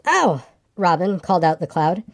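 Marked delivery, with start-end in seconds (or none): 0.1-0.4 short, high "ow"; yelp of pain. A narrator's reading. Pooh is stung while calling his friend, yelping. Bee stings Pooh mid-call.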